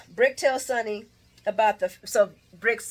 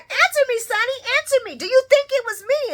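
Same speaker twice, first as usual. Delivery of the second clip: high-pitched, louder mock demand; Brick; 14 semitones higher, 6 dB louder, faster than usual. Vlogger mimicking Brick confronting his boss, high-pitched. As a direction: push it high, insisting on an answer. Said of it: "Voice still high".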